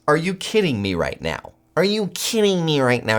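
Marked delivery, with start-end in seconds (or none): 1.8-3.2 higher, incredulous repeat of the question; really can't believe it, go up on it; pitched-up disbelief. Real, not acted.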